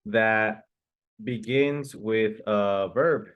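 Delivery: 1 slow emphatic. Shown as a demonstration. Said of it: "A general question is".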